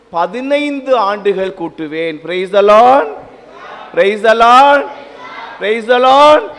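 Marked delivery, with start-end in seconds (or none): 2.2-6.4 loud ringing call and response; fervent shout of praise to the congregation; preaching call. Real, not acted.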